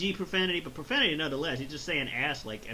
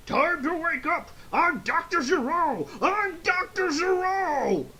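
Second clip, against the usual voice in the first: high, loud wailing mock cry, stretching out at the end; hammed-up announcing; 13 semitones higher, 5 dB louder, slower than usual. Robot waking from its pod, high hammed-up wailing yell. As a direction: proclaim the name in a rising, exaggerated yell.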